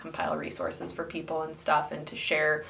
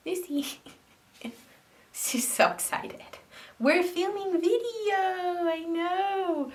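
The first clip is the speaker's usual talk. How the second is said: pet talk; 12 semitones higher than usual. Real, not acted. Talking to excited dog while filming.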